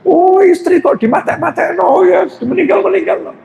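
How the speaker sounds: very high indignant protest; wife protesting to husband, very high and indignant; offended wife. Retold or imitated. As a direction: squeal out her protest up high.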